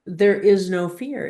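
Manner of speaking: ordinary talk, nothing marked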